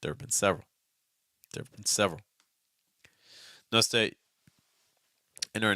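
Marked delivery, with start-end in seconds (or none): 0.0-2.2 "there have been" contracted, stress landing on "several"; low, weak "been". Shown as a demonstration. Teacher drills students, "there have been" contracted and weak. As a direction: keep "been" low, then lift "several". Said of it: "you're going low enough on the bend".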